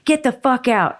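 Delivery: a performer ordering a heckler out, mock anger; retold angry onstage order. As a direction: throw the heckler out with a sharp command. Retold yelling at heckler on stage.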